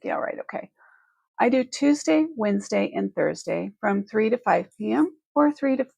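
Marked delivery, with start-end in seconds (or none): 0.0-0.7 breathy unvoiced mock agreement; sarcastic whisper. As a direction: whisper it like a doubtful viewer. Viewers' imagined sarcastic reply.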